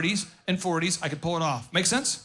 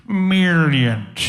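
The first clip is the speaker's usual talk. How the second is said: drawn-out, pinky-to-lip Dr. Evil "Millions"; louder villain drawl on "Millions"; mock supervillain gloat; Dr. Evil impression; 2 semitones lower, 7 dB louder than usual. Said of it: "in that Austin Powers".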